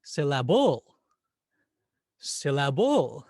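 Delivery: "Syllable" as sil-la-BULL, loud last beat; third-syllable stress. Shown as a demonstration. Teacher testing a wrong stress, exaggerated sil-la-BULL. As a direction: throw the weight onto the end of "Syllable": sil-la-BULL. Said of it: "What about the third syllable".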